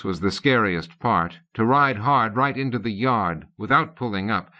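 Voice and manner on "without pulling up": ordinary delivery, nothing marked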